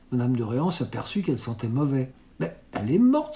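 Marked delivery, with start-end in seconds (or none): 2.4-3.4 sudden high cry of disgust; startled exclamation. A narrator's reading. Madame de Réan finds the tortoise dead, startled cry. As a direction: exclaim it much higher, recoiling. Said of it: "s'écria-t-elle".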